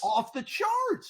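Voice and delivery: "Off the charts" said high and drawn out slowly; emphatic echo. Real, not acted.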